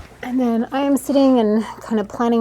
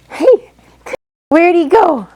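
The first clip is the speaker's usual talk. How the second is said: higher, louder playful surprise; playful call; 9 semitones higher, 12 dB louder, slower than usual. Real, not acted. Photographer playfully searching for toddler brother, excited.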